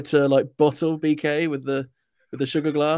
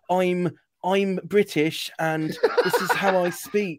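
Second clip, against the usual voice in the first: slow, hammed-up posh British accent; mock British accent; 3 semitones higher, much slower than usual. Mocking an actress's fake British accent, slow and hammed up. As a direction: lampoon an American faking a British accent. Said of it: "because it's that very".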